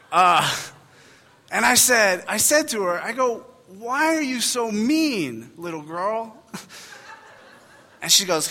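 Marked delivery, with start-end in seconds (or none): 3.7-6.4 slow, high, drawn-out mock reproach; retold hurt reproach. Dad asking the little girl who insulted his baby, mock reproach. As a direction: stretch it out, pleading and offended. Retold scolding of rude child.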